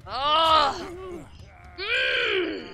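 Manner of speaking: loud high whining groans; frustrated groans. Real, not acted. Streamer stuck far from a hook, loud whining groans.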